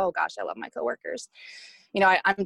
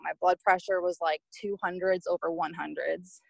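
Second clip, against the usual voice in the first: ordinary talk, nothing marked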